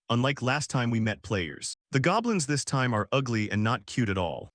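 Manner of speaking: ordinary delivery, nothing marked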